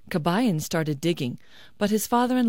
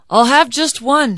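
higher, louder character voice; eager hungry man; 7 semitones higher, 10 dB louder than usual. Acted. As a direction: give in to hunger, pitched up and loud. Hungry man talks himself into eating.

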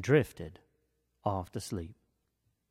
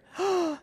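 shocked gasp. A narrator's reading. Mama bear finds her chair.

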